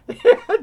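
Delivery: high, loud burst of laughter; sudden high laugh; delighted laugh. Real, not acted. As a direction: laugh out at the scene. Laughing at the confrontation scene.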